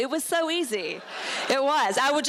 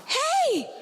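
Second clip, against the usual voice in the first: mock calling out; 13 semitones higher than usual. Calling boys for attention.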